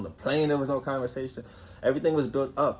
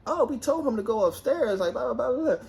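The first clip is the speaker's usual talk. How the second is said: much higher mock innocent voice; high sing-song imitation of the other girl; mocking girly voice; 11 semitones higher than usual. Mimicking the other girl's excuse in a high mocking voice. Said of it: "this girl gonna be like".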